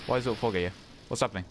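ordinary talk, nothing marked

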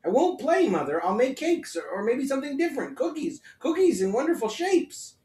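put-on boy begging to help, excited; eager boy. A narrator's reading. Herschel pleading with his mother to bake.

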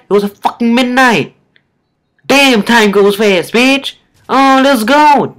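high, hyped goofy put-on voice; fake voice. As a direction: cheer loudly in a silly fake voice. This ain't my real voice.

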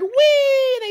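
a high, loud delighted whee; gleeful whee. Acted. Sneetches sliding down, a high, loud whee. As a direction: squeal down the slide.

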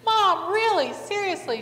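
high-pitched, incredulous protest; mock exasperated, starting loud; retold exasperation. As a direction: act out exasperation with mom. Exasperated reply in retold quarrel.

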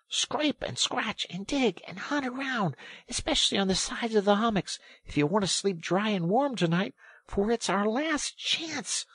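high-pitched, insistent orders; angry urging. A narrator's reading. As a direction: drive a friend to dig urgently. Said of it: "really angry".

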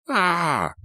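high, sustained mock scream; narrator's acted shriek of fright; frightened scream. A narrator's reading. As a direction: let out a high startled cry. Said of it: "She screamed in fright".

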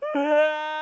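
high loud hammed-up wail; mock cry. Gundul answering the grandfather, loud mock crying. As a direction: howl like a crying child. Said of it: "dijawab dengan tangis".

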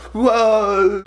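high, drawn-out wobbling whoa; startled whoa. Retold or imitated. Fan stepping off the ramp, high startled whoa. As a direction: yelp as your footing goes.

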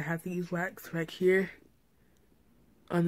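lip held open by finger; talking with lip stretched aside; finger pulling lip down. Real, not acted. Seen 2: Finger touches then pulls lower lip down, showing braces, mid-speech.

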